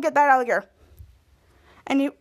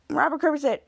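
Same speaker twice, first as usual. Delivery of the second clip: ordinary delivery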